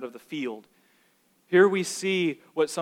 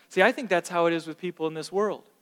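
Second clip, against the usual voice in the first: ordinary talk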